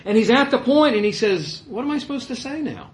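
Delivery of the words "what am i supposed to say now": high-pitched, acted bewilderment; mock helplessness. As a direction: play at being at a loss. Mock quote of a clueless Jesus.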